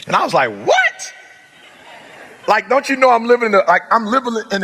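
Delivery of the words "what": high, disbelieving squeak of surprise; stunned what; shocked what. Retold or imitated. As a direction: react like it's an absurd idea. Told to get a GED.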